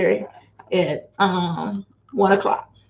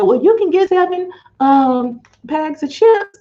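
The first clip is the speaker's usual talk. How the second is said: happy retold quote; 6 semitones higher, 3 dB louder than usual. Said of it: "I was so happy when they said".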